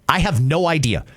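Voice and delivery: ordinary delivery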